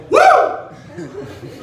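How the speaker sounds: high whooping cheer; a big fake fan whoop; mock cheer woo. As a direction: let out a hammed-up sports woo.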